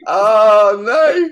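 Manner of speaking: high, loud wail of playful dismay; big drawn-out protesting cry. Real, not acted. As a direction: cry out "oh no", pitch climbing sharply. Dismayed at being left hanging.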